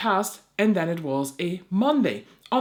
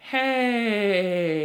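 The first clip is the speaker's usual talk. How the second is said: drawn-out greeting; 3 semitones higher, 4 dB louder than usual. Retold or imitated. Creator calling DHL again, drawn-out greeting. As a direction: stretch the word right out. Retold over-sweet phone greeting.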